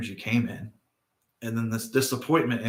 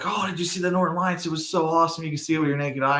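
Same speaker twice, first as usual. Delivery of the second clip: higher, eager retelling of the neighbours' buzz; excited gushing; 5 semitones higher than usual. Retold or imitated. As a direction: gush about the lights like the whole town. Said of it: "everybody's excited".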